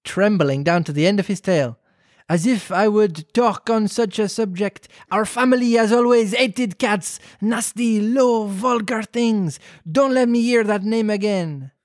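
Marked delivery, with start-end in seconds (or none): 2.3-11.7 put-on high, slow French accent; drawn-out, indignant French-accented rant; sassy French mouse. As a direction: scold haughtily as an offended French mouse. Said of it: "you do the sassy French mouth".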